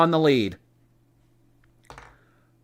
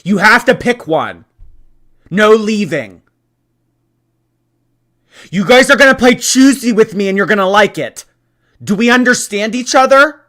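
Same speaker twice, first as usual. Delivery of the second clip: mock bossy tyrant; 7 semitones higher, 10 dB louder than usual. Forcing the audience to play Choosy.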